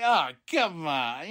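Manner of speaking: slow, easy-going smooth talker; lightly voiced slick charmer, stretching it out; a hint of a smooth operator brushing it off; smooth Nick Aldis. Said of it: "being smooth Nick Aldis that he is".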